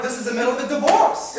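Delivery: high, loud correction; loud, outraged retort; indignant shout. Acted. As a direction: top your spouse with a yell.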